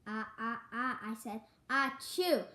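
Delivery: ah ah building to a loud achoo; hammed-up sneeze; acted sneeze. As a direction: wind up and sneeze big. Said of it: "I sneezed".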